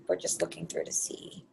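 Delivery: trailing into a murmur. Real, not acted. Host reading through incoming questions, murmuring absently. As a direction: let the voice sink away while searching.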